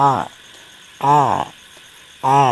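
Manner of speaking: আ pressed from the middle of the throat; throaty ain. Shown as a demonstration. Teacher demonstrates Arabic ain to students. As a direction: squeeze আ out from mid-throat. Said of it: "হলকের মাঝখান থেকে চাপ দিয়ে সরে আ".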